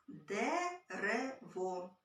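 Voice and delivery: very slow, each sound drawn out; slowly stretched word. Shown as a demonstration. Teacher slowly says a test word in the clapping game. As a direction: lay the word out slowly so kids can listen. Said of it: "Наприклад, слово".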